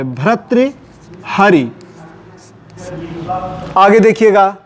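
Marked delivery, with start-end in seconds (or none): 0.0-1.7 भर्तृहरि very slowly, pause before हरि; drawn-out bhrat-tri, then hari in भर्तृहरि; careful correct form. Shown as a demonstration. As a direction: dictate भर्तृहरि slowly in two parts. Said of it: "गलती ना होने पाए".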